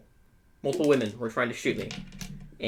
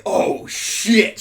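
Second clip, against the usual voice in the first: sharp, high, loud startled exclamation; alarmed curse; 10 semitones higher, 9 dB louder than usual. Retold or imitated. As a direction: blurt it out as the car turns in. Panicked exclamation seeing the car.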